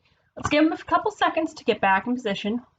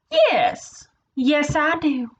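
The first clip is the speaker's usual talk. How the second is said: put-on high-pitched excited yes in accent; 5 semitones higher, much slower than usual. Nebraska agreeing with friend Kansas, excited high voice. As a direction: jump in high and eager. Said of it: "said Nebraska excitedly".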